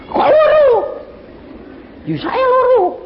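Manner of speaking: high, loud, bewildered exclamation; astonished. Retold or imitated. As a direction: reenact the shock of seeing your own double. Soul sees two of himself.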